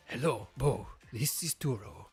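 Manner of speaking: smouldering Spanish dude voice; Puss in Boots Spanish accent. Acted. Turo tells Bo about Miraidon, in a put-on Spanish accent. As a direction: play the professor as a suave Spanish cat hero. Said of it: "make him sound like Puss in Boots".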